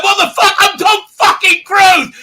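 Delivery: high, indignant shouting star; outraged Tom Cruise. Retold or imitated. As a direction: yell as a furious famous actor. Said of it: "He's like".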